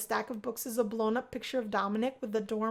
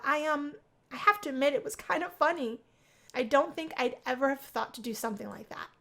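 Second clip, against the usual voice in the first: plain reading voice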